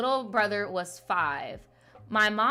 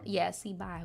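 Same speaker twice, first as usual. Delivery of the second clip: ordinary talk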